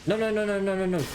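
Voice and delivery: high, quick no no no; panicked correction; flustered protest. Real, not acted. Correcting a misheard word.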